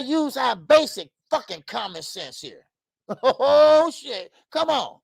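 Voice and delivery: ordinary talk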